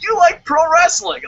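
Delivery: high, loud host voice; bright TV host. Pretend. Today Show host asking the guest in a chirpy voice. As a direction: play the chirpy morning host. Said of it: "they're like".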